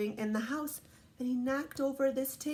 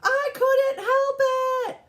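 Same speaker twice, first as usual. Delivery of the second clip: put-on very high, loud, slow kid protest; each word stretched, high and loud; David's excuse; 10 dB louder, slower than usual. A narrator's reading. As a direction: wail it like a child blaming circumstances. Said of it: "He says".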